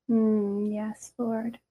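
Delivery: soft whispered prayer, slow; hushed prayer. Real, not acted. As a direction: murmur it to God, barely voiced. Quiet prayer to the Spirit.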